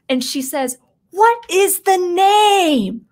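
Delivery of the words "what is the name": high, loud and slow, drawn out demand; demanding shout. Retold or imitated. Ahsoka demanding the name from the dying prime minister, loud and slow. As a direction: bark the question right in his face. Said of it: "That's how she says it too".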